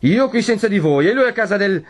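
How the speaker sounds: high-pitched raging threat; distraught cry. A narrator's reading. Renzo threatens to go after his rival, distraught and raging. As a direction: rave on, beside yourself. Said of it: "gridò Renzo con un viso più che mai stravolto".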